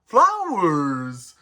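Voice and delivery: high, drawn-out delighted cry; Bashful. Acted. Bashful picks up flowers and shows them off, delighted. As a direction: coo over each word, gliding downward. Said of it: "picked up them flowers and went".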